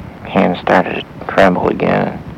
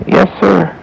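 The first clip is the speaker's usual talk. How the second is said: put-on boy voice; 11 semitones higher, 3 dB louder than usual. A narrator's reading. Boy answers old man.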